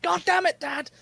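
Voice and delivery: high-pitched comic cursing at dad; acted outburst from the trapped son; mock exasperated cry. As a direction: wail it like a hammed-up fed-up son. Son caught in bear trap.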